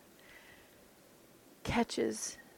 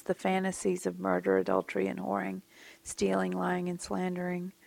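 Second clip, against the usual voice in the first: hushed list; 7 dB quieter than usual. Acted. Repeats the list much softer.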